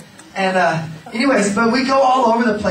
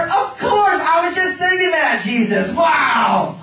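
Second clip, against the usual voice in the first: eager reply; 9 semitones higher, slower than usual. Retold or imitated. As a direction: gush it back, shouting. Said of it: "you wanna go hug a leper?".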